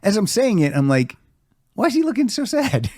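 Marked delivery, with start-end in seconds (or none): 1.7-2.9 very high, squeaky aside; comic high-pitched bewilderment; puzzled thought. Retold or imitated. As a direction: wonder it in a high, small voice. Retells puzzled thought while saying it.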